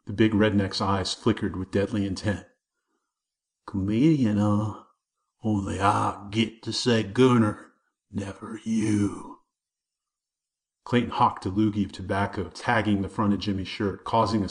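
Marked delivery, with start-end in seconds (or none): 3.6-9.4 bully's threat. A narrator's reading. Clayton threatening Jimmy about Bucky Breeze, slow and menacing. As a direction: lean in and threaten.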